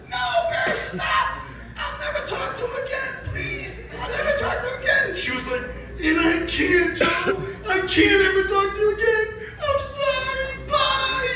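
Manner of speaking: high, frantic begging, near shrieking; a hammed-up hysterical plea to stop; panicked pleading. Comic as the friend begging Bear in a high frantic plea.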